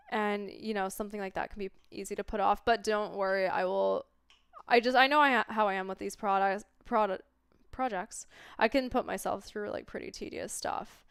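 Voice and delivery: usual speaking voice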